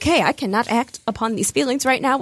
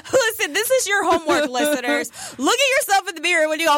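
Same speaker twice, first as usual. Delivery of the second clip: normal talking voice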